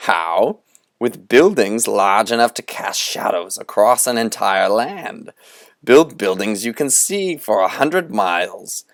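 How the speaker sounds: Moundstrout preaching. Acted. Conjurer rousing the boys to build a cathedral, grandly. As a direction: build it up like a fiery sermon on cathedrals.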